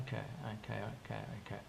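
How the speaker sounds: soft, quiet okays, giving in; resigned okays. Retold or imitated. To the pastor, quietly conceding with soft repeated okays. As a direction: murmur okay over and over, yielding.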